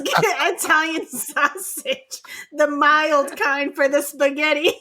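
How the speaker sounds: slow, drawn-out impression of a father; imitating Dad. Daughter imitating Dad ordering sausage, slow mock-dad voice. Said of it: "He goes".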